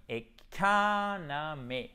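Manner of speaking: "economy" very slowly, syllable by syllable; prononciation modèle. Shown as a demonstration. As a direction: stretch out "economy" with a long stressed second syllable. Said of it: "pour la prononciation". (« economy » très lentement, syllabe par syllabe)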